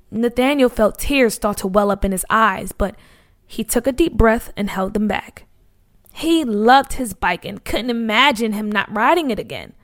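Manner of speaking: plain reading voice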